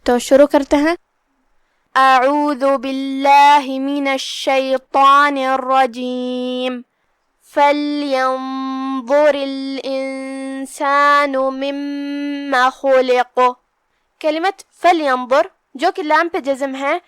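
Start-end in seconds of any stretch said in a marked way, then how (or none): 2.0-6.8 slow, drawn-out chanted recitation; Quran recitation. Acted. Teacher opening the tajweed lesson, slow chanted recitation. 7.5-13.4 slow, drawn-out chanted recitation; Quran recitation. Acted. Teacher opening the tajweed lesson, slow chanted recitation. Reciting the verse before explaining.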